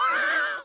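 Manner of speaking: squealing burst of laughter; real laugh at own bit; high laugh. Real, not acted. Streamer cracking up at his own joke, squealing laugh.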